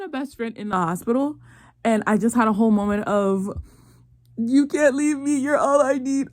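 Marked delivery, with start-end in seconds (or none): 4.4-6.3 hammed-up clingy plea, pitched up; mock melodrama. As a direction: play it like a cheesy tearful farewell. Mock melodramatic friend farewell.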